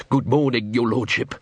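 ordinary delivery, nothing marked

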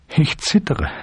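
high, pleading confession; on the edge of crying; close to tears. A narrator's reading. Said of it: "Er fing an zu weinen".

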